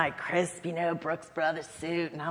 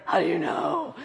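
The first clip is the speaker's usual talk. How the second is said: laughing astonishment. Retold or imitated. Pregnant staffer stunned the First Lady guessed, laughing shout. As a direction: blurt it out, caught off guard. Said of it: "And I was just like".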